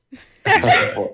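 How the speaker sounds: lightly voiced tough bodyguard; a hint of a hulking shark henchman. Shown as a demonstration. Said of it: "another role in the game called James the Shark".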